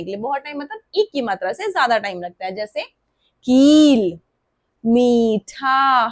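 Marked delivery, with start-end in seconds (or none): 3.5-6.1 कील and मीठा held long; stretched long ee. Shown as a demonstration. Teacher stretches long ई words for kids. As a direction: stretch each ee slowly. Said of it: "stretch करना पड़ता है".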